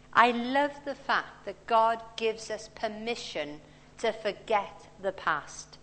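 ordinary talk

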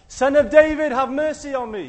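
high, loud cry for mercy; a blind beggar yelling to be heard; desperate shout. Retold or imitated. Blind beggar shouting at the passing crowd, loud and desperate. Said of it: "he shouts".

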